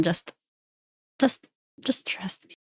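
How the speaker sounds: whispered plea to the price chart; barely voiced, begging it to trust him. Real, not acted. Trader whispering to his chart, begging the price to move.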